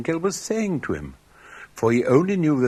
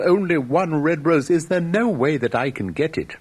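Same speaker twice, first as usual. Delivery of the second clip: higher, louder, desperate plea; pleading cry; 6 semitones higher, 5 dB louder than usual. A narrator's reading. Nightingale begging the tree for one rose, high and loud. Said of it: "cried the nightingale".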